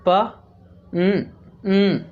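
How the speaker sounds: प then a humming nasal न, न; sound by sound. Shown as a demonstration. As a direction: break पंच into sounds, stressing the nasal न. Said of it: "नाक से आवाज़ आ रही ना". (प, फिर नाक से न, न)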